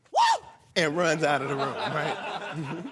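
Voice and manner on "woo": shrieking diva whoop; high loud whoop. Retold or imitated. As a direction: let out a piercing high woo. Drag queen whoops.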